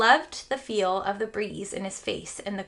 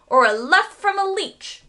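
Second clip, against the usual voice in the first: loud, higher bellow; 9 semitones higher, 6 dB louder than usual. A narrator's reading. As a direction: roar it back at him. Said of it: "bellowed the man".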